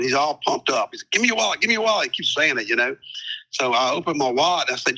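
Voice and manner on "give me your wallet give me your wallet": hollered demand. Retold or imitated. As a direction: holler it at him. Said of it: "keeps hollering".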